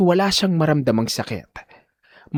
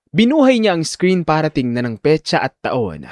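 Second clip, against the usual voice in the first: ordinary talk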